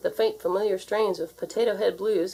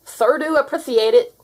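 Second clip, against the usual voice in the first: toothless grandfather; 7 semitones higher, 8 dB louder than usual. A narrator's reading.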